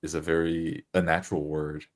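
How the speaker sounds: ordinary talk, nothing marked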